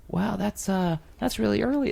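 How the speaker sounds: imitating a jittery nerdy guy; mocking Scott as a high squeaky Marty McFly type. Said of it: "sound like Marty McFly".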